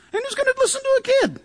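high, quick mocking squeal; scornful mimicry. Acting scornful neighbours.

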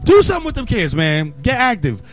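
ordinary talk, nothing marked

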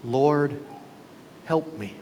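low, softening plea for help; retold cry for help, hushed and falling; quiet plea. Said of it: "she cries out".

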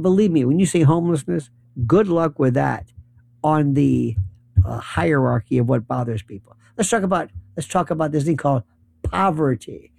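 normal talking voice

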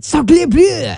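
high-pitched, playful mock-French exclamation; Lumiere's French accent. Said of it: "try to get a little Lumi".

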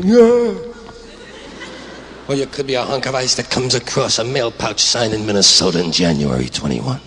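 ordinary talk, nothing marked

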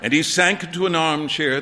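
ordinary delivery, nothing marked

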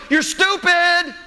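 very high, stretched-out mock tantrum yell; imitating yelling kid. Kid in a tantrum yelling over store shelving, mock yell. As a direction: mimic a child calling out, pitched high and drawn out. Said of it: "yelling over, over the shelving".

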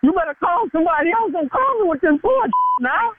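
high-pitched, heated denial; voice getting high. Real, not acted. Prank victim denies telling on coworkers, voice rising high. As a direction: protest with the voice climbing high. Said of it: "Why your voice getting high?".

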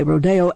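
"Rodeo" pronounced ro-DAY-o; second-syllable stress. Shown as a demonstration. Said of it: "with the accent on the second syllable".

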